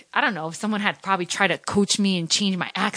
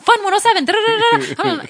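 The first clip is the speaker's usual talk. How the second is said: much higher, louder radio-jingle delivery; imitating her fast old radio voice; fast DJ read; 14 semitones higher, 5 dB louder than usual. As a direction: rattle off the station ID like an eager young DJ. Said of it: "like, fast".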